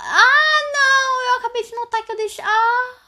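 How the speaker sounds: high loud sudden alarm; shocked outburst. Real, not acted. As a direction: shriek in dismay and rush. Realizes she ignored a message.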